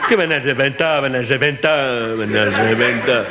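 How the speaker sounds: defiant scoffing. Acted. Victim answering the robbers' threat, scoffing. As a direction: throw the threat back, drawing each repeat out.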